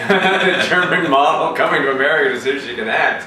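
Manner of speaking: amused, talking through laughter; laughing. Real, not acted. Host laughs at the story.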